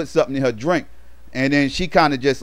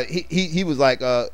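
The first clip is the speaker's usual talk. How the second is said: ordinary delivery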